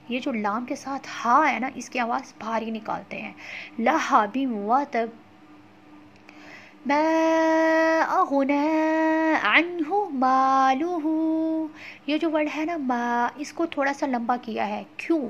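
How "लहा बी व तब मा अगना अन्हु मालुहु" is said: very slow recitation; slow tajweed chant; Quran recitation. Shown as a demonstration. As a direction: draw out each word. Recites verses slowly.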